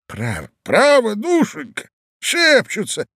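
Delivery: put-on high, loud old man; drawn-out, high-pitched old man's insistence; old husband. A narrator's reading. Old man telling his wife he hears whispering, high and insistent.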